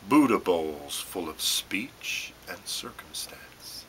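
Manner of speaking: normal talking voice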